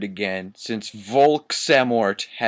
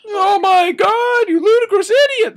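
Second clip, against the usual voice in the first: very high, loud hammed-up scream; shrill mock shout; 8 dB louder than usual. Reading the fanfic to a friend, a shrill hammed-up scream. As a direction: scream it like an outraged cartoon. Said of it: "they both shooted angrily".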